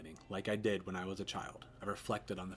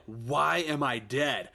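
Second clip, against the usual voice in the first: put-on high, slow and louder child's voice; the little boy pressing, louder; insistent child; 5 semitones higher, 8 dB louder, much slower than usual. Ghost son demanding an answer, slow and loud.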